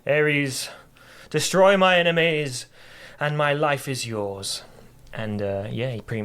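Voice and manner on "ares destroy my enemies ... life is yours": loud, higher mock battle cry, slowing on the vow; mock shout to Ares. Kratos calling up to the god of war, hammed-up loud plea. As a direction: play the desperate general calling on his god. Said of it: "he shouts up to Ares".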